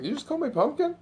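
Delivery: high indignant echo; high mock indignation. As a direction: throw it back in mock outrage. Brother quoted incredulous.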